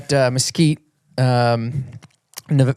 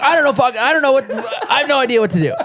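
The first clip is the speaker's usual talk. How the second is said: put-on high, overwhelmed kid voice, laughing; mock-frantic son on the start gate; panicked kid; 12 semitones higher, 3 dB louder, much faster than usual. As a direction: squeak out the confused kid's panic. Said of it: "And he's like".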